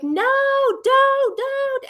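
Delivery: very high, drawn-out mock scream; imitated scream. Mother retelling screams at her swimming daughter, imitated scream. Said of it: "I'm, like, screaming".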